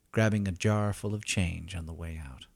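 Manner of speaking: ordinary delivery, nothing marked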